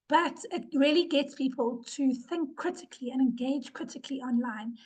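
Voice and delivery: real sick, voiceless whisper; losing voice. Real, not acted. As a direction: voice gone to a near whisper from illness. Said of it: "I'm losing my voice".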